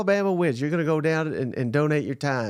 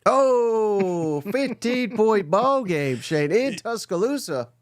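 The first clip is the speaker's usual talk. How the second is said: higher, very slow play-by-play drawl; mock broadcaster call; 5 semitones higher, much slower than usual. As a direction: ham up the score call like a TV announcer.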